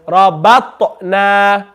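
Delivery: slow Rabatna, smiled ba, echoed t; smiley ba. Shown as a demonstration. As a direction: smile through ba and stretch the na of Rabatna. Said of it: "read the ba smiley".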